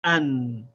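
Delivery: "an" said clipped, stopping dead on the n; "an" as one closed syllable; demo syllable an. Shown as a demonstration. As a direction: clear teaching demo of "an". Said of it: "We say".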